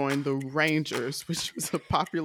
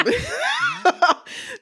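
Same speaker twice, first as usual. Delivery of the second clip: high laugh; 5 dB louder than usual. Real, not acted. Laughs after the Kamala joke.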